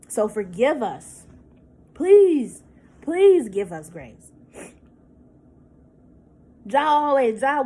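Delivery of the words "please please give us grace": loud, drawn-out "Please"; plead, leaning hard and slow on "Please"; emphatic plea. Real, not acted. Woman begging viewers for grace, emphatic pleading.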